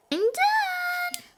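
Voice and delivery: very high, drawn-out squeal; triumphant. Real, not acted. Child finished painting the doggy, triumphant high squeal.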